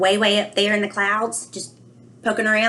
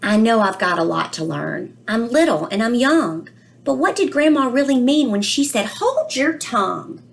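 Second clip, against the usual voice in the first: put-on a child's worried storybook voice, read aloud gently; reading to children; 5 semitones higher than usual. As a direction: read it to the class like a puzzled little boy. Reads book aloud, child narrator.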